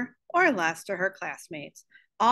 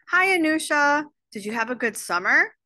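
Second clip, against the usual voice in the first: high, louder friendly hello, lifting at the end; pitched-up, eager small-talk question; chirpy greeting; 6 semitones higher, 4 dB louder than usual. Acted. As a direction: greet a shy classmate brightly and high. Cheery greeting acted in story.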